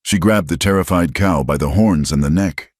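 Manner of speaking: plain reading voice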